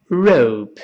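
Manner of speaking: ROPE with curled English r, falling; correct rope. Shown as a demonstration. Teacher repeats for the class, ROPE with English r. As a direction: model ROPE as one syllable, tongue curled back. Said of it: "舌尖勾回来".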